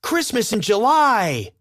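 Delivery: high, loud happy cry; excited shout. Acted. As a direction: shout it with delight. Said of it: "Marlene shouted".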